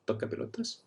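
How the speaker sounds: "toca pelotas" whispered without voice. Shown as a demonstration. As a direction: breathe "toca pelotas" as a secret. Repeats insult for learners.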